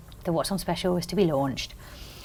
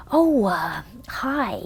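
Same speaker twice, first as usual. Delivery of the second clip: hesitant put-on American accent; an amateur's tentative American accent; 3 semitones higher, much slower than usual. Caller disguising herself on the phone, hesitant American accent. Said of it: "I put on this accent".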